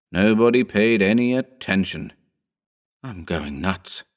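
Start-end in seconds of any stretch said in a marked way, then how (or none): 3.0-4.0 hushed, slow near-whisper. A narrator's reading. Lois to himself at the sight of the body, whispered. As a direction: say it softly and slowly, under your breath. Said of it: "Lois whispered".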